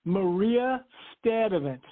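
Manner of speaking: "Stadivant" said slowly apart from "Maria", no R; R dropped. Retold or imitated. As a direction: demonstrate "Stadivant" without its first R. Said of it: "they did not pronounce the R in Sturdivant".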